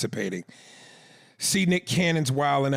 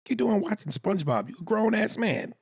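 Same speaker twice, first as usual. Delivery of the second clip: mock disbelief; 11 semitones higher than usual. Real, not acted. Teasing co-host about SpongeBob.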